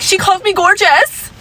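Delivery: high, whiny, confused imitation; mocking the Karen. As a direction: squeak it out like a baffled Karen. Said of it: "she's like".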